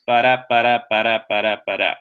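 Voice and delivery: BA-DA in five even pulses, DA stressed each time; iambic beat. Shown as a demonstration. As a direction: chant "ba-da" as a steady iambic rhythm. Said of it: "five stressed syllables".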